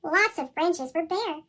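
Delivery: ordinary delivery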